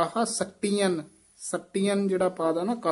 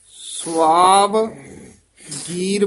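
very slow ਸਵਾਵ, a gap, then ਗੀਰ; split after ਸਵਾਵ; 8 dB louder, much slower than usual. Shown as a demonstration. Teacher showing the word division, long ਸਵਾਵ then ਗੀਰ. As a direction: draw out ਸਵਾਵ and break before ਗੀਰ. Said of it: "ਇੱਥੋਂ dash ਦੇਣੀ ਹੈ ਵਾਵੇ ਤੋਂ".

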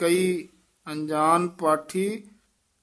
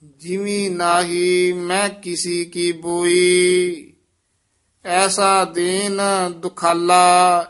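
slow, raised reading of the verse; Gurbani recitation; 2 semitones higher, 6 dB louder, much slower than usual. Acted. Teacher reciting the shabad for the student. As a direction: chant the hymn out solemnly.

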